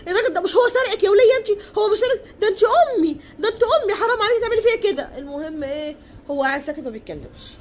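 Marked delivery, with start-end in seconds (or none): 0.1-5.2 high, tearful pleading, voice pushed up and louder; crying while pleading. Retold or imitated. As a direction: beg through tears, half laughing as she retells it. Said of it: "وانا عماله اعيط واقول له". (بكاء وتوسل بصوت عالي)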